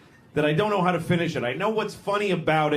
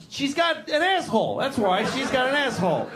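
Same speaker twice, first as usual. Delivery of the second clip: duck husband arguing; 8 semitones higher than usual. Acted. Acted quarrel between duck spouses.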